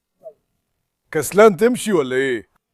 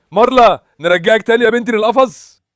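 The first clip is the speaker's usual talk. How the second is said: calling the dog; 6 semitones higher than usual. Real, not acted. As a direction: call her name to get her back.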